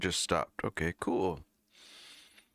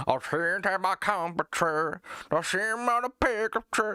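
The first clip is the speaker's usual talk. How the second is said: high, twangy mock country singer; generic country singer; 10 semitones higher, 3 dB louder than usual. Streamer mocking country singers who sound alike, high drawn-out twang. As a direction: draw out a put-on country twang. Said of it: "it was just, like, a whole different voice".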